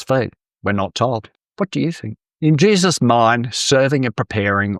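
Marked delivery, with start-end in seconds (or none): none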